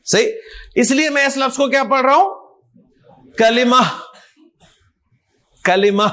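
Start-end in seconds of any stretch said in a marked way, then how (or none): 3.4-6.0 کلمہ ending kalimah with an h; final h, no t. Shown as a demonstration. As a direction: say کلمہ without any t at the end. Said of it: "کلمت تو نہیں کہہ رہا نا".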